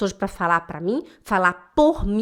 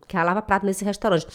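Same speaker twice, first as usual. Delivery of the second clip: normal talking voice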